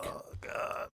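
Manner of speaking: breathy, barely voiced oh god; a real appalled whisper after reading the texts; horrified whisper. Real, not acted. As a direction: whisper it in disgust. Reacting to disturbing love messages.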